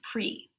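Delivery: "pre" said softly with a long E; soft demo of "pre" with long ee. Shown as a demonstration. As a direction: stretch the E in "pre" as in "pree". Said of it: "it is a long E sound".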